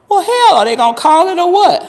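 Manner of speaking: high, loud yell at the refs, fast on the end; outraged yell. Retold or imitated. Fan yelling at the TV over a missed call, outraged yell. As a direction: yell at the TV in disbelief. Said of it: "and I'm going".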